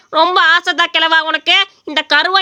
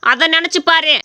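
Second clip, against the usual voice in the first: ordinary delivery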